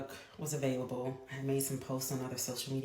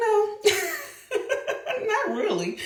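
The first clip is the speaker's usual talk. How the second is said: put-on much higher, giggly child voice; laughing son; 8 dB louder, much slower than usual. Mother imitating her son laughing at the cartoon of him, high giggly child voice. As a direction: giggle it out like an amused boy.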